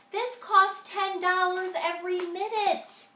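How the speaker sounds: upset yell. A narrator's reading. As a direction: scold him over the phone bill. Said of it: "yelled my sister".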